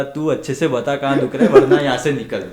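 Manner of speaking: laughing mock yell at the patient; laughing mock scolding. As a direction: bark at him, grinning. Doctor scolding evasive patient.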